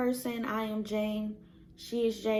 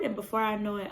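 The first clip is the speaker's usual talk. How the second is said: normal talking voice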